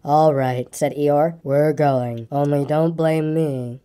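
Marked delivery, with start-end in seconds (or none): 0.0-0.7 low, slow donkey grumble; gloomy Eeyore. A narrator's reading. Eeyore complaining from the end, low gloomy voice. 1.4-3.8 low, slow donkey grumble; gloomy Eeyore. A narrator's reading. Eeyore complaining from the end, low gloomy voice.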